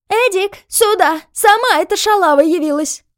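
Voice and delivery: high, screechy yell; shrill shout. A narrator's reading. Landlady calling her son into the yard, shrill. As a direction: shriek for backup in a piercing voice. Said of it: "визгливо закричала хозяйка".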